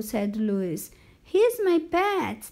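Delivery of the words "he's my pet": high-pitched insistent defense; pleading protest. Acted. Boy defends pet to coach.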